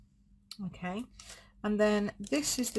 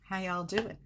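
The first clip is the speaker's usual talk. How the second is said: attempted American Southern accent. Shown as a demonstration. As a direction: greet them in an amateur American Southern accent. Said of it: "as good as my American Southern accent gets".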